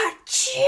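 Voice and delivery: squeaky high achoo; high sneeze. Acted. Wolf sneezes.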